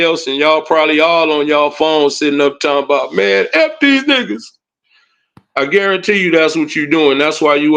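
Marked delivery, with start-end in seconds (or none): 3.2-4.4 higher, sneering imitation; mocking troll voice. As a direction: mimic the griping troll.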